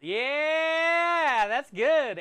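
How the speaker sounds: high, loud burst of delight; excited yeah. Real, not acted. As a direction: cheer the good news out loud. Happy reaction to Saint Jude story.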